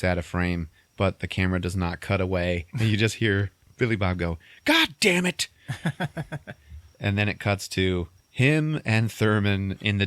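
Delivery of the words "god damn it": loud, high mock-angry shout; frustrated curse. Man giving in off screen, frustrated shout. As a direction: burst out cursing, pitched way up.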